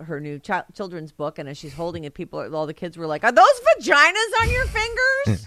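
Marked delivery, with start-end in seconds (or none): 3.2-5.5 put-on high, loud child's voice; little kid voice. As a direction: ask it like a wide-eyed little kid. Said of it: "the little kid voice that you can".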